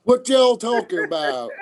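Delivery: high, laughing mock Southern accent. New Yorker keeps up the Southern route, mock Southern accent. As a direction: put on a high Southern twang. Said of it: "go the Southern route then".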